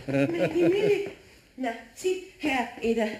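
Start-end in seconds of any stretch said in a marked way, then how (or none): 0.1-1.1 anxious calling. Acted. Calling anxiously about the milk.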